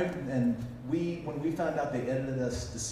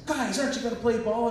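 wheedling producer; 11 semitones higher than usual. Retold or imitated. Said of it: "And the production company's".

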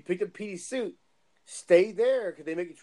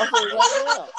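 high loud laughing admission; laughing confession; 9 dB louder than usual. Real, not acted. Brother admitting he did it on purpose, laughing.

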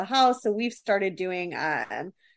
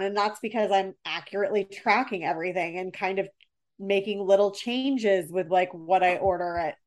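ordinary talk, nothing marked

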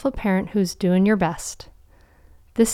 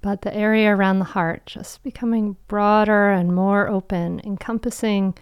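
guided meditation. Acted. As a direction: guide them into calm, with long pauses.

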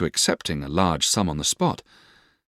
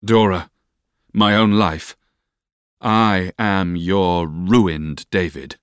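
slow, weighty declaration; solemn, drawn-out confession; 4 dB louder, much slower than usual. A narrator's reading. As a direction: announce it gravely, pausing between phrases. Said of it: "I solemnly repeated".

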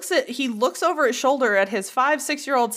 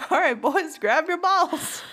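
dad's line again, higher; 7 semitones higher, slower than usual. Retold or imitated.

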